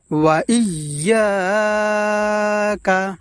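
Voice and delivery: ইয়াকা with ইয়া held long; drawn out recitation. Shown as a demonstration. Teacher demonstrating for viewers, long ইয়া in ইয়াকা. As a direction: chant ইয়াকা slowly, stretching ইয়া. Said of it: "লম্বা উচ্চারণে টান দিয়ে পড়ব".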